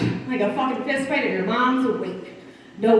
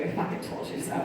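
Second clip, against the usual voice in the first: hushed, breathy I fucking told you so; sneaky whispered gloat; whispered aside; 8 dB quieter than usual. Shown as a demonstration. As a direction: mutter fucking under the breath. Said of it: "without having to tell you".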